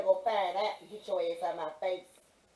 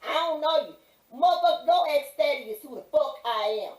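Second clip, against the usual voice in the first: higher, louder outrage; retold angry shout; 4 semitones higher, 6 dB louder than usual. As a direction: shout down the guard again. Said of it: "You must don't know who I am".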